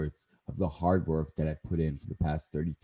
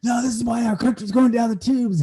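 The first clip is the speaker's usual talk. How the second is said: much higher, louder ranting mock anger; mad Andy Frisella; 7 dB louder than usual. Retelling the Tinfoil Hat podcast, mock angry rant. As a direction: rant it like a furious tough guy. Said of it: "he started getting really mad".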